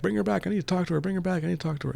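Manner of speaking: high mock yell at ordinary volume; retold yelling. Dusk begs at closed gates, mock yelling. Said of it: "no matter how much she yells".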